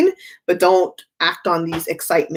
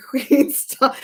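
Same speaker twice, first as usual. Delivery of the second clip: normal talking voice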